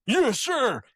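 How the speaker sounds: high snappy mock salute; high, eager soldiers' reply; soldiers answering. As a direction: bark it back like eager troops. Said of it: "everyone goes".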